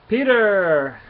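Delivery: acted fatherly shout of greeting; calling out. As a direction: hail your son loudly, falling at the end. Father calls out to his son.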